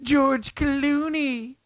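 Conan nerd voice. Pretend. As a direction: stretch the name out like a nerd. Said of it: "does he talk like that?".

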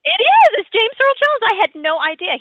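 gleeful game-show confirmation; excited reveal. Real, not acted. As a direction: announce the right answer with a burst of delight. Host reveals correct answer excitedly.

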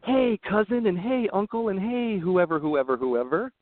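high, slow preachy mock call; hammed-up self-righteous greeting; mocking high horse. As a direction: lord it over the relatives in a high sing-song. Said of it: "get up on your high horse".

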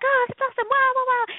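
high, gushing backstage chatter; mock gush over small talk; chatty gushing. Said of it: "We're like".

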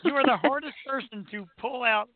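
ordinary talk, nothing marked

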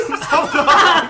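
drawn-out mock cereal commercial; hammed-up ad slogan. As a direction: sell the slogan like a cereal ad, laughing.

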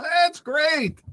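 mocking upbeat reassurance, high then dropping; Barack Obama. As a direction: parody a rosy sales pitch, starting way up high. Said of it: "according to Barack Hussein Obama".

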